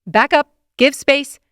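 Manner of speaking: loud, high barked order; command mode. Acted. Narrator ordering kids away from the choking boy, loud command. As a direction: order the kids back like a drill sergeant. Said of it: "My voice cracks into command mode".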